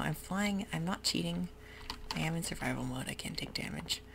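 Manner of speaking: ordinary delivery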